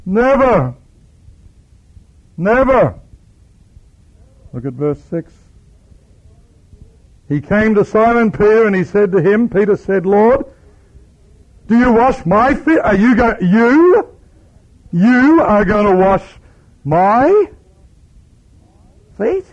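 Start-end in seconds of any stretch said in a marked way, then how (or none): 0.1-3.0 high, loud outraged cry; indignant refusal. Acted. Peter protesting to his master, outraged loud cry. 10.1-19.4 high, loud disbelieving question; incredulous protest. Acted. Peter aghast that Jesus will wash his feet, high incredulous cry. Peter shocked at foot washing.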